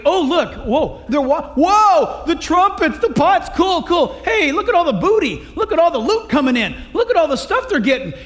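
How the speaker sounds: gawking excited. Acted. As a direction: gush like kids spotting treats. Ephraim gawking at the loot.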